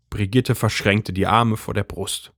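ordinary delivery, nothing marked

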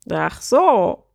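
lightly croaked realisation; croaking bird prince. A narrator's reading. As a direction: give a small squawk of understanding. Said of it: "krächzte der Vogelprinz".

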